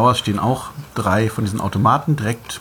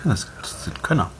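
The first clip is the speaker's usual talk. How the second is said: whisper; 2 semitones higher, 5 dB quieter than usual. Real, not acted. Quiet aside while eating.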